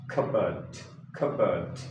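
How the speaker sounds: cupboard said "KUB-ud", p silent; correct way. Shown as a demonstration. Teacher models the correct cupboard. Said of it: "it becomes cupboard".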